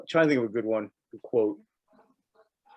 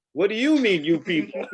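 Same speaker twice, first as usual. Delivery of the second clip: high, louder, laughing indignation; indignant; 7 semitones higher, 3 dB louder than usual. Retold or imitated. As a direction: throw it back offended. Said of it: "What is with you people".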